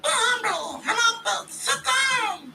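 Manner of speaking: very high falsetto shouted chant. Retold or imitated. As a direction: yell the lines in a squeaky high rap. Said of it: "That's my impression of [name]".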